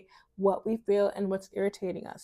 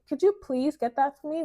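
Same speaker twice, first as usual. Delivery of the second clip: higher, sweet, courteous ask; polite request; 6 semitones higher than usual. Shown as a demonstration. Woman asking someone to fetch something, sweetly polite. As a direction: ask nicely, lilting on please. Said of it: "learn to use polite language".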